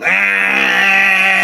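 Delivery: loud high straining engine wail; engine strain imitation. Trucker imitating his engine straining up the hill, loud high wail. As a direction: mimic a bogged-down engine screaming uphill. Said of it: "the engine's going".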